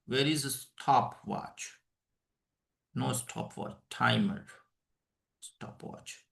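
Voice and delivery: low murmur under breath, half whispered; muttering to self. Real, not acted. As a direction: mutter to yourself while searching. Muttering to self finding stopwatch.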